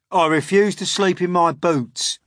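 high, loud Centipede protest; defiant cry. A narrator's reading. Centipede refuses to sleep booted, defiant cry. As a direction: refuse loudly as a brash bug. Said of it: "the Centipede cried".

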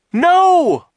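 very high, loud, long scream; drawn-out piercing yell; anguished scream. A narrator's reading. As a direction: scream in horrified protest. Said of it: "Klaus screamed".

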